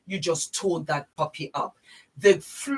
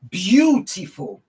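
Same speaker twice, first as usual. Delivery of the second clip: slow, loud beautiful; savoured beautiful; 2 semitones higher, 6 dB louder, slower than usual. Real, not acted. Vlogger admiring her legs, slow and loud. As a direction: draw it out admiringly.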